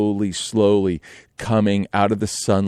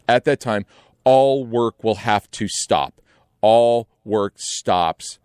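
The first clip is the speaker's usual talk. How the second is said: slow solemn order; much slower than usual. Retold or imitated. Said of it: "he goes".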